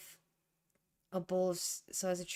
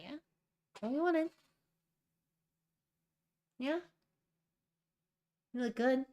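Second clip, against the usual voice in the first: cooing to cat; 6 semitones higher than usual. Real, not acted.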